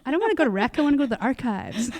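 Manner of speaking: put-on a kid begging, half laughing; laughing mock whine. Mock kid whining at job.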